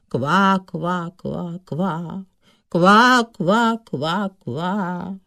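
wailing frog croaks, each run falling away; lamenting. A narrator's reading. Lovesick suitor wails alone under the water, lamenting. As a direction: lament in croaks, starting high and sinking. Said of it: "Seděl pod vodou a naříkal".

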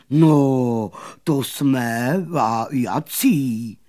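slow, loud, droning boast; buzzing bumblebee. A narrator's reading. Bumblebee boasting of being a musician, slow buzzing drone. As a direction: buzz it with stretched vowels. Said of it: "zabzučel čmelák".

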